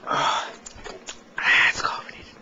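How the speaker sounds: hushed whisper after a sip. Real, not acted. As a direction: breathe the words out, unvoiced.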